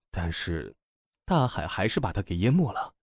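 ordinary delivery

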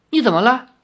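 high, alarmed question; startled. A narrator's reading. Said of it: "吃了一惊".

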